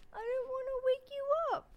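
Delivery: high, slow whine, imitating a crying roommate; mock tearful whimper. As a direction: whimper it high and drawn out, hammed up. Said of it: "She was like".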